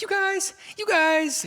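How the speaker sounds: very high goofy squeal; high wacky persona. Retold or imitated. Comic showing friend the voice from his set, high wacky squeal. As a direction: pipe it up in a silly stage voice. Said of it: "but I was like".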